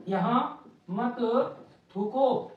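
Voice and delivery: each word spaced out with long pauses; slow dictation. Shown as a demonstration. Teacher dictating यहाँ मत थूको to the class, slowly word by word. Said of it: "जैसे हमने लिख दिया".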